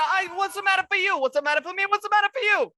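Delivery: put-on high-pitched, put-upon New Yorker accent; offended New Yorker accent. A devil denies everything to the threatening paladin, in a high New Yorker accent. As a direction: play a New Yorker devil insisting nothing's wrong. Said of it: "like, super offended New Yorker accent".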